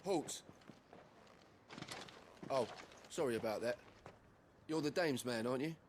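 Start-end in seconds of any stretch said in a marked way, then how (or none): 0.0-0.4 high-pitched guard's call to stop; sharp challenge. Acted. Guard stops a visitor at the garrison, sharp challenge. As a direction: call out to stop someone in their tracks.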